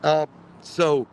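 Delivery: ordinary delivery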